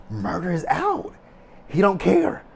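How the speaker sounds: high-pitched, elated exclamation; happy amazement. Retold or imitated. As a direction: exclaim it with thrilled disbelief. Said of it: "I was happy".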